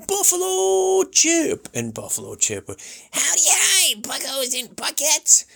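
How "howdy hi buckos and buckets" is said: high squeaky cartoon sidekick; cute sidekick. Imitating the cute sidekick greeting the kids. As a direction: chirp it like an adorable little buddy. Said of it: "cute, cuddly, adorable little sidekick".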